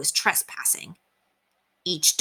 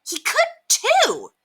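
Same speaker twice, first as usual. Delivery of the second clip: high, slow, indignant comeback; hot retort; 3 dB louder, much slower than usual. A narrator's reading. Henry defends his dog against Scooter, hotly. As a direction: stretch it out in heated protest. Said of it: "said Henry hotly".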